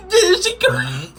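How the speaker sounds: high, slow, through helpless laughter; laughing hard. Real, not acted. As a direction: laugh so hard the words squeak out. Laughing fit at fall video.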